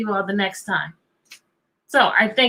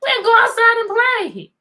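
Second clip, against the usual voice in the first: imitating the kids; 8 semitones higher than usual. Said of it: "some of you all said".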